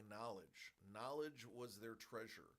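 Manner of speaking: a hint of quiet, solemn narration; lightly imitating a grave film epilogue. Harrison Ford's closing line, lightly done in a quiet, solemn tone. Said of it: "in the same tone as the epilogue to Blade Runner".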